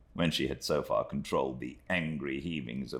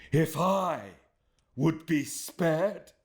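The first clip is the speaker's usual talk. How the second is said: higher, slow, fierce scornful echo; fierce retort; 13 semitones higher, 3 dB louder, slower than usual. Acted. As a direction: throw her words back at her fiercely. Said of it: "returned the other fiercely".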